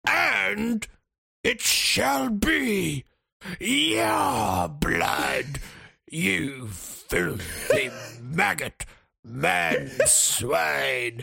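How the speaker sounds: hammed-up orc speech, extremely drawn out; bloodthirsty orc. Orc enemy threatening the player before battle, slow hammed-up menace. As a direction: proclaim doom with long, swooping vowels. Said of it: "it's all like".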